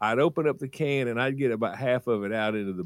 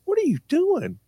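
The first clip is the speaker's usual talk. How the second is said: high sharp mother's voice; mom's alarmed question; 4 dB louder than usual. Retold or imitated. Mom catching her son at the gas pump, high alarmed voice. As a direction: mimic mom catching him. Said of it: "she looks out the car door and she said".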